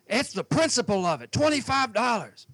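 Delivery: mock outraged boater. Boater complaining about the fee.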